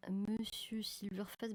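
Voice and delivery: slow, hushed naming of the software; slow whisper. Real, not acted. Streamer whispering the name of the scanning software to viewers. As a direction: breathe the name out slowly.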